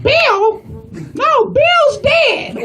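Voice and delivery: put-on high-pitched old lady voice; drawn-out, high old lady; elderly woman customer. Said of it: "She's like".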